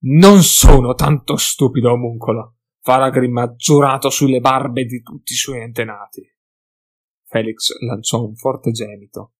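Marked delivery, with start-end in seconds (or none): 0.1-6.3 loud furious dwarf growl, slowed at the start; angry dwarf growl; growling with anger. A narrator's reading. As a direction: snarl it at him, offended. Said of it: "il nano ringhiò di collera".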